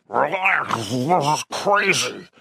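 high, garbled growling words; werewolf growl. A narrator's reading. Werewolf Rob tries calming an attacker, growling unintelligibly. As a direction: roar speech through a wolf's muzzle. Werewolf pleads with panicking lobby crowd.

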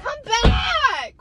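high shout after him; calling out. Acted. Girlfriend shouting after boyfriend who walks off, calling out. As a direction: call him back, loud and high.

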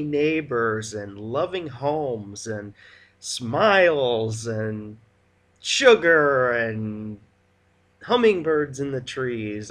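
slow, loud, syrupy sarcasm; sarcastic sugary. Acted. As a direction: lay on the sweetness, mocking it. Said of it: "Sarcasm, note it".

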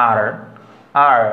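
আর with the final r fully sounded; isolated আর ending on a held American r; American pronounced R. Shown as a demonstration. Teacher showing how Americans say it, আর with sounded r. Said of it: "আমেরিকানরা আর সবসময় উচ্চারণ করে".

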